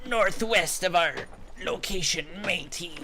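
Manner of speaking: slow, high hammy pirate. Acted. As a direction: play a goofy pirate captain.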